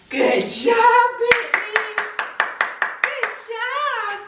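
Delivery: high, sung good job; high singsong dog praise. Real, not acted. Praising dog after roll.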